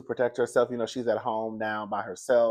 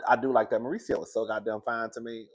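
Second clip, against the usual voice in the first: ordinary talk, nothing marked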